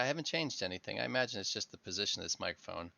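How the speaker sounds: ordinary delivery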